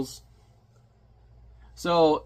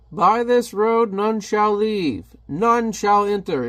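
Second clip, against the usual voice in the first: slow, loud, higher villain pronouncement; menacing guard; 7 semitones higher, 4 dB louder, slower than usual. Retold or imitated. The guard warning Hellboy off the road, slow booming menace. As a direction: proclaim it like a doom-laden gatekeeper.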